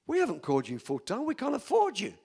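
mock-incredulous board, pitch leaping up; indignant protest. Church board to unexpected full-time pastor, protesting in disbelief.

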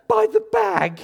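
very high, loud squeal; panicked wife imitation. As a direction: shriek it like a frantic wife, imitated. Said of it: "because she went".

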